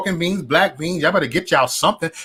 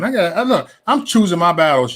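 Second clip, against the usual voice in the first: ordinary talk, nothing marked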